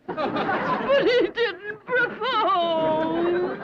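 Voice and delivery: hammed-up crying lament; tearful wailing. Jilted woman tells Granny she failed, tearful wailing. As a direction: wail through it, drawing out the last word.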